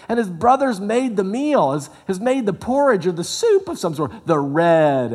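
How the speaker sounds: high, loud pulpit delivery that keeps climbing; full-force preaching. Acted. Preacher urging the congregation about Esau, in a loud, high preaching voice.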